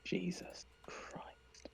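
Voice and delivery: very slow, stretched whispered curse; shocked whisper. Real, not acted. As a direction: swear under your breath, drawing it out. Reacts to mishap while building.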